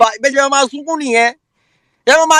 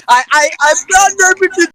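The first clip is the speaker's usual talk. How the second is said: mock wailing politician; faster than usual. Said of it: "come say".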